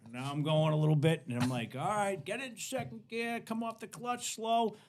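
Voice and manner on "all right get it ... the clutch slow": imitated coaching. Driving lesson in a bucking car, imitated coaching. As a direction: talk someone through the gears.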